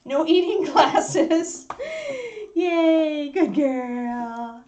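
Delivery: high, drawn-out pet voice; high-pitched doting dog-owner voice; puppy talk. Real, not acted. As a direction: coo it slowly, as to a naughty puppy. Talking to puppy chewing glasses.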